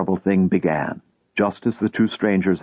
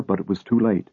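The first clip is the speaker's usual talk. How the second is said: ordinary delivery, nothing marked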